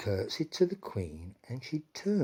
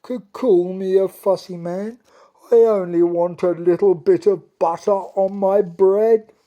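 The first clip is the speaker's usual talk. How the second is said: whimpering; 7 semitones higher, 13 dB louder than usual. Acted. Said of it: "he whimpered".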